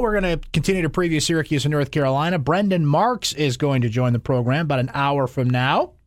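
normal talking voice

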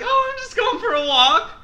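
very high, loud falsely casual voice; mock innocent reply. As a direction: act innocent and nervous. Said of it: "I was like".

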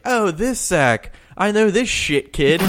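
a hint of a teasing aunt, drawn out; lightly mimicking the aunt greeting him, slow and high; imitating the aunt. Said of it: "She's like".

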